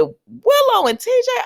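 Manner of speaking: high, drawn-out disbelief; incredulous. Retold or imitated. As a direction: stretch the names up high in disbelief. Acts out his disbelief.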